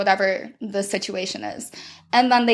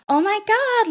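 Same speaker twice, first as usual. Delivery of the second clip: put-on cutesy baby voice; 11 semitones higher than usual. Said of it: "Or the little baby voice comes out".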